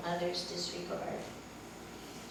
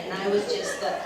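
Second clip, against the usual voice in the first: ordinary talk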